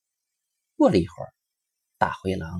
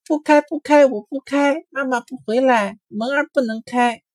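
very high, louder firm refusal; defiant bunnies; 5 dB louder than usual. A narrator's reading. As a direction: stand firm, won't open. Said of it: "小兔子们一起说".

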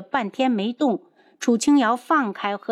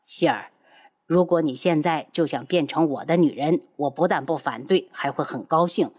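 much lower, playful man; low teasing lover; 5 semitones lower than usual. Acted. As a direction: tease her in a low voice.

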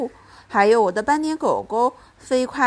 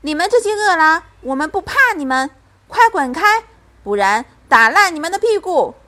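loud, high, fast scolding; furious mother defending her child; angry shouting; 7 semitones higher, 8 dB louder than usual. A narrator's reading. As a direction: chase them off with a fierce threat. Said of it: "她怒气冲冲地站在门边".

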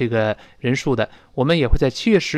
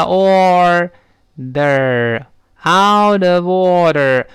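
"out of order" stretched slowly and firmly; slow practice; 3 semitones higher, 5 dB louder, much slower than usual. Shown as a demonstration. Teacher building up the phrase for students, very slow. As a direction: hold every syllable of "out of order". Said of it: "我说慢".

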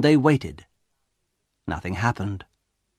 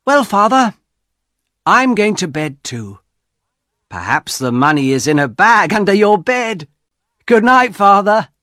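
higher, louder joking tone; teasing son; 9 semitones higher, 6 dB louder than usual. Acted. Son joking with his father before bed, playful. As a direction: tease Father about the money under the bed.

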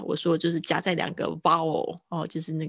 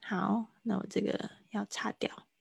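whispered aside while erasing the board; 9 dB quieter than usual. Real, not acted. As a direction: drop to a whisper for a quick aside. (擦黑板时的耳语旁白)

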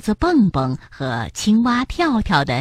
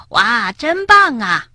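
high, slow, drawn-out wow; amazed exclamation; 12 semitones higher, 4 dB louder, slower than usual. A narrator's reading. Frog marvels at storks overhead, amazed. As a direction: marvel out loud, stretching the words. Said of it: "跳跳惊叹道".

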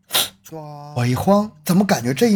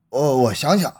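normal talking voice

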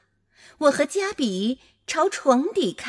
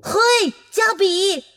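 louder, higher, excited call; cheering; 6 semitones higher, 7 dB louder than usual. A narrator's reading. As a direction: cheer the monster's name. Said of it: "我欢呼道". (兴奋地欢呼)

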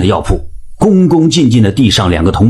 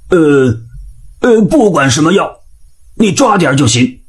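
hesitant, higher-pitched improvising; stumble, then cover with a higher voice; flustered; 6 semitones higher than usual. A narrator's reading. Old man caught without an answer at the pharmacy, flustered. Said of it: "老汉愣住了".

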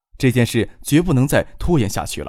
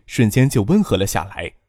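ordinary delivery

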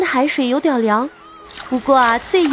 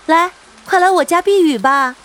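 calling out; 5 semitones higher, 3 dB louder than usual. Acted. Neighbour inviting them in.

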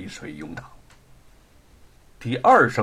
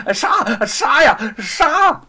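rising higher and louder with each kill; frantic yelling; 9 semitones higher, 6 dB louder, slower than usual. A narrator's reading. Luo Pang, running about, screams at the executioners, frenzied. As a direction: scream the order again and again. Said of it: "跑来跑去的大喊".

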